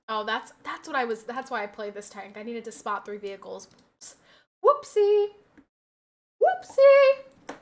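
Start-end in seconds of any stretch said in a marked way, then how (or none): 4.6-5.3 squeaky high "whoopsie"; cutesy high-pitched oops. Pretend. In-game mistake. 6.4-7.6 even higher louder "whoopsie"; shrieked cutesy oops; higher squeaky whoopsie. Pretend. In-game mistake, again.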